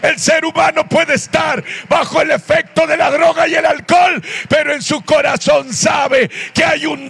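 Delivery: high-pitched, shouted sermon climax; fervent preaching. Real, not acted. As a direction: proclaim it to the whole congregation at full force. Preaching climax at full force.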